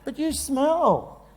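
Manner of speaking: put-on high, slow, drawn-out childish taunt; squabbling brother. One brother insulting the other in a fight, high and taunting.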